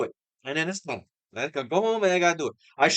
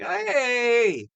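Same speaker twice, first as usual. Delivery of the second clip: drawn-out, high "hey", acting out interest; intrigued reaction; 6 semitones higher than usual. Fan retelling his reaction to a trailer, intrigued. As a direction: stretch it out high, like something caught your ear.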